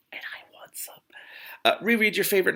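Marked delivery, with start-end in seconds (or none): 0.1-0.9 hushed, longing whisper; a secret whispered craving. Real, not acted. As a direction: confess the want under the breath. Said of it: "whispered craving".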